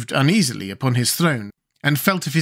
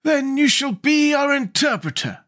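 high-pitched, courteous Scarecrow king; 14 semitones higher than usual. Acted. Scarecrow appoints the girl interpreter, high and courteous. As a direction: play a regal Scarecrow, pitched way up.

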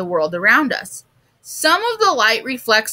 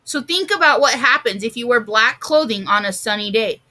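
plain reading voice

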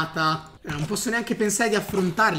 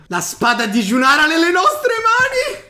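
high, loud heroic declaration; triumphant proclamation; 9 semitones higher, 7 dB louder than usual. Acted. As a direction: proclaim the victory.

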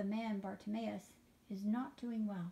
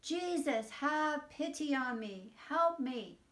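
louder, higher, pleading cry of "have pity on me"; shouting louder; 5 semitones higher, 8 dB louder, slower than usual. A narrator's reading. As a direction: cry out over the people who hush him. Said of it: "But he shouted even louder".

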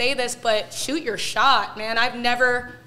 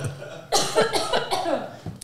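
real breathy cough, still clearing the throat; 8 semitones higher than usual. Real, not acted.

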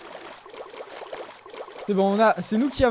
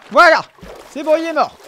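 loud triumphant cry; excited; 7 semitones higher, 12 dB louder than usual. Real, not acted. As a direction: crow over the kill. Kill celebrated. (cri de triomphe)

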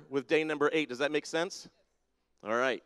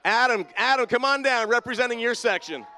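loud and much higher, calling across the hall; 7 semitones higher, 7 dB louder than usual. Real, not acted. Pastor calling Adam down to represent his section, loud and high. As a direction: boom his name to the back rows, game-show style.

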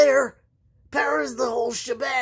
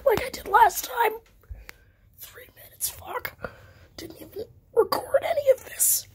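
whispering with no voice; hoarse breathy whisper, voice gone; lost voice whisper; 11 semitones higher, 5 dB quieter than usual. Real, not acted.

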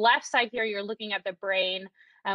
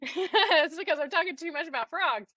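laughing; 6 semitones higher than usual. Real, not acted. Laughing at her own joke.

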